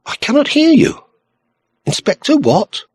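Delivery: high, light voice on the telephone; lilting lady straining to hear; faint musical voice. A narrator's reading. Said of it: "An answering voice, faint but musical".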